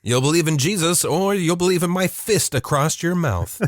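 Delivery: hammed-up dad making threats; threatening dad. Dad threatening his unbelieving kid, mock angry.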